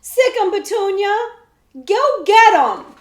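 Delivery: loud, high command; fierce cry. A narrator's reading. Girl sets her bear on the outlaws, shouting. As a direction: urge the bear on.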